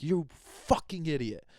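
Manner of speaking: slow, sneering mock insult. To someone he's cursing at, a sneering insult. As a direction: spit it out like an imagined insult. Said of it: "I'm not, like, going".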